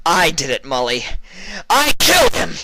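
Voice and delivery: very high and loud scream. A narrator's reading. Vinyl screams confession at Mully, full scream. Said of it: "she screamed".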